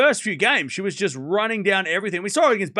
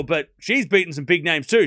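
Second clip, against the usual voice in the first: ordinary talk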